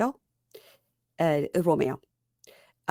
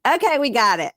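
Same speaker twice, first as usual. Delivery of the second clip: louder, higher mock exasperation; exasperated; 6 semitones higher, 6 dB louder than usual. Host cutting off the lecture, loud exasperated. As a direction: enough already, spoken loud and high. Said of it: "So I was like".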